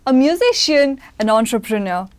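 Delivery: bright, loud announcer-style welcome; upbeat host introduction; show host intro. Acted. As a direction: present the guest like a show opener.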